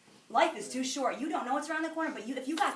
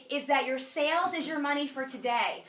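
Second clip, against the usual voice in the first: normal talking voice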